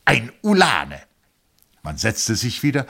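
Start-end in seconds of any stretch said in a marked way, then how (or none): 0.0-1.0 fond tone. A narrator's reading. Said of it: "in dem liebkosenden Ton".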